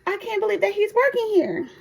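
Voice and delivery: very high gushing disbelief; excited coworker gossip. Retold or imitated. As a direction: squeal it in giddy disbelief. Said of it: "She said".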